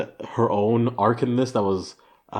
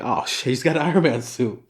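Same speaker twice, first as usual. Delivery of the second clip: lightly voiced worried cop; mock dismay with a laugh in it; alarmed cop; 4 semitones higher, 4 dB louder than usual. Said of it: "one of the cops saying".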